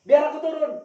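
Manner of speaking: furious passenger demanding to get out; angry demand. Retold or imitated. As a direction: shout that you're getting off. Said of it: "Oh, marah-marah".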